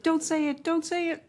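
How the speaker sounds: put-on high, pleading don't say it like a nervous child; a schoolkid dreading a lost recess, pleading high; anxious kid pleading. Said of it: "I think we all remember that feeling. Like".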